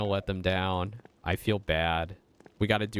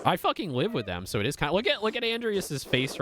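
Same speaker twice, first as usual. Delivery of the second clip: getting mad; 10 semitones higher than usual. Real, not acted. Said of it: "I'm getting mad too".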